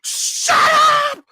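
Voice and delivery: high, loud shut up; sharp yell. Retold or imitated. Yelling at the dog to silence its tags. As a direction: snap at the noisy dog. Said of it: "I'm like".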